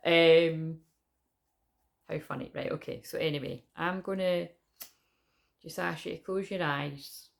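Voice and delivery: ordinary talk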